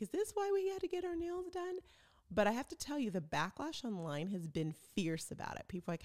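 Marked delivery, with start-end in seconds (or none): 0.0-1.8 much higher, mock-surprised bride voice; mimicking the bride. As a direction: squeak it like a stunned fiancée. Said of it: "She's like".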